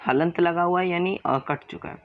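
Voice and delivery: usual speaking voice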